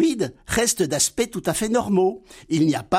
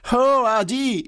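higher, naive sidekick voice; Laurel's voice; 6 semitones higher, slower than usual. Acted. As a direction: play the simple-minded friend, high and eager. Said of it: "Dialogue imaginaire entre Laurel et Hardy". (voix aiguë et naïve de Laurel)

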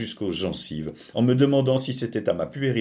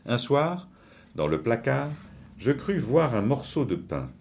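plain reading voice

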